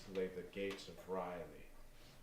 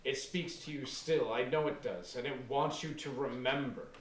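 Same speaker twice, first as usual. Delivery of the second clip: intense fervor; 4 semitones higher, 7 dB louder than usual. Acted. Aaron intensifying.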